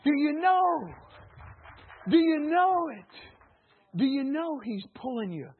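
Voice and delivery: high, loud, shouting sermon delivery; full-force preaching. Real, not acted. Preacher rousing the congregation, loud and high. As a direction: preach it out at the top of your voice.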